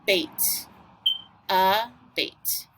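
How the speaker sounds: "a" and "bait" as separate beats with long pauses; syllable by syllable. Shown as a demonstration. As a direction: dictate abate in halves, a, then bait. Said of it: "So once again, we have".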